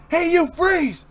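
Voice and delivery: high, loud command to freeze; guard shouting. Acted. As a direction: bark at the intruders. Guard catches intruders.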